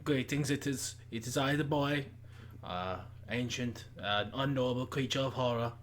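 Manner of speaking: low, hushed, mundane vampire; a bored creature of the night, softly; spooky vampire voice. Acted. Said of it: "maybe with spooky vampire voice, but very mundane".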